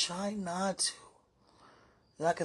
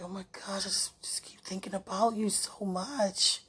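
whisper. Real, not acted. Whispering to sweetheart how much she misses him. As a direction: breathe the words, overwhelmed. Lovesick voice message.

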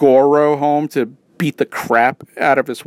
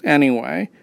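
usual speaking voice

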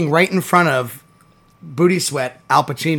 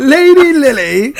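laughing mock title; 11 semitones higher, 8 dB louder, slower than usual. Joking Bridgerton names.

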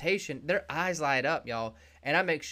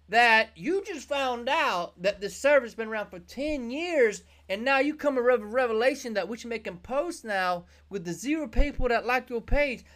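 exasperated employee; 8 semitones higher, 3 dB louder than usual. Retold or imitated. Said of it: "And you're like".

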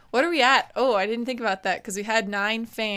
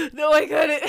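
mock sob; 6 semitones higher, 5 dB louder than usual. Mock despair after Avenger fantasy.

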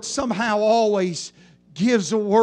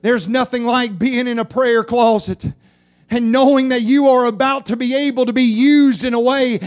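normal talking voice

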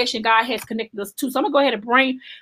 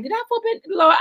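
worried question; 6 semitones higher than usual. Retold or imitated. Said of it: "I was like".

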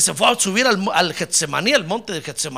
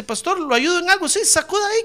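high-pitched polite exchange; 11 semitones higher than usual. Acted. Eager helper and pastor acted out.

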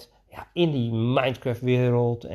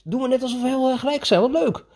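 put-on much higher, bright, sing-song enthusiasm for a child; high, eager play voice cheering on a boy; playful excited coach voice; 12 semitones higher, 3 dB louder than usual. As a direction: gush like you're thrilled by his idea. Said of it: "Dus ik zei".

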